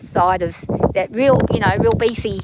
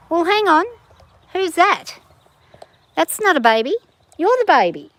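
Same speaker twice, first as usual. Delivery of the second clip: high pet talk; 8 semitones higher, 6 dB louder than usual. Real, not acted. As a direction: coo at the chick, pitched up and loud. Talking to baby chickens.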